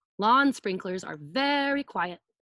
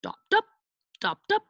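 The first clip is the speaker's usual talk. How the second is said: imitated footsteps; 11 semitones higher, 5 dB louder, faster than usual. Shown as a demonstration. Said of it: "his shoes go dop".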